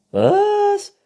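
very high, loud „Was?“; Waschbär. A narrator's reading. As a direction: squeal it as the raccoon. Raccoon figure, shrill question. (hoher, lauter Waschbär-Schrei)

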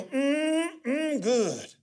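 high, drawn-out mm of enjoyment; delighted mock hum; savoring hum. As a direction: imitate relishing a long sip. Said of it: "And he'd go".

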